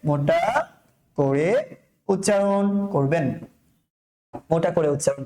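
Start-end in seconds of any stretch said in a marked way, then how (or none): 0.0-3.4 slow, with pauses between the words; word-by-word dictation. Shown as a demonstration. Teacher repeating the rule to students, slow and separated. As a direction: spell the rule out piece by piece.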